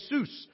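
"Jesus," said heh-SOOS with an h sound; "Jesus," in Spanish, heh-soos. Shown as a demonstration.

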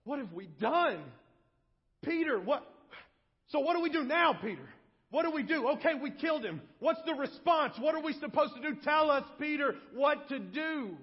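high, loud, urgent appeal from a crowd; crying out. Acted. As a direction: plead loudly and urgently for an answer. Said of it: "they cry out in response".

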